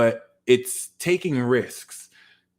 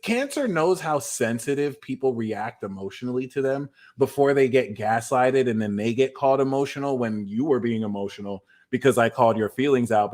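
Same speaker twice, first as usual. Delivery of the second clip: normal talking voice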